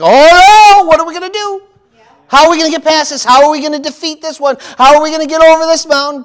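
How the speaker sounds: high, loud panicky mock wail; mock freak-out. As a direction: ham up the panic of a worried believer. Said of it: "the first inkling is to freak out".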